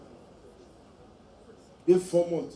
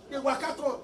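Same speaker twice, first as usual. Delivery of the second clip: ordinary talk, nothing marked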